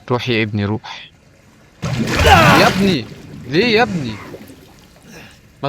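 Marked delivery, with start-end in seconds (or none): 2.3-4.1 sudden high yelp of fright; real startled outburst; startled yell. Real, not acted. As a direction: jump and yell at the scare. Jump scare in horror game.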